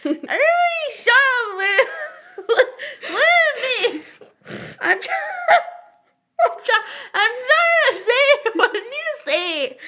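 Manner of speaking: loud laughing demand for attention; laughing shout. Real, not acted. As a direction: yell over everyone, cracking up.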